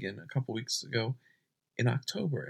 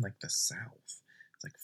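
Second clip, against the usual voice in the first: quick whispered aside; 3 semitones lower, 5 dB quieter than usual. Real, not acted. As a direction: drop into a breathy whisper. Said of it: "if I'm being too loud and waking up my parents".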